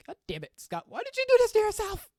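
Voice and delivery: high, loud wail at himself; squealed, hammed-up mock cry; mock anguished yell.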